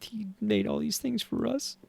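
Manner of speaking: real choked-up voice, high and soft; overcome, voice lifting high and thin; getting emotional. Real, not acted. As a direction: marvel through rising tears. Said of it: "why I'm getting emotional".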